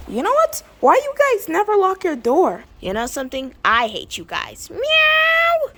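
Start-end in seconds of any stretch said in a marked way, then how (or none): 4.7-5.7 very high loud mock meow; cat meow. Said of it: "who on earth is making that cat noise".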